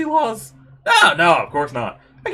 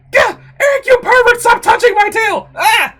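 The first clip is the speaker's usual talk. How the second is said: much higher, loud outraged yell; furious shriek; 13 semitones higher, 10 dB louder than usual. Acted. Tiger girl whose tail was grabbed, furious shriek. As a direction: scream at the pervert who grabbed you.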